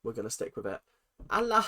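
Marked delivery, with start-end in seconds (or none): 1.3-1.6 put-on Essex accent. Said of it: "I can't keep up that accent".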